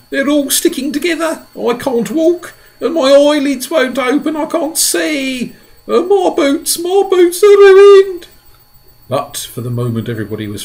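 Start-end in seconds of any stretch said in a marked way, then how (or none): 0.1-8.3 high, loud distraught complaint; crying centipede. A narrator's reading. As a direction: howl about his ruined boots. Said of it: "he cried".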